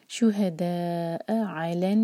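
recited with clear throat आइन; Quran recitation. Shown as a demonstration. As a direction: chant शुहदा अलन, आइन from the throat. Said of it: "आइन को clear हलक से".

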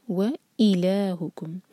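व and ई kept clearly apart in व इलाहुकुम; separate wa and i. Shown as a demonstration. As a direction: sound व, then ई, distinctly. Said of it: "clear अलग अलग".

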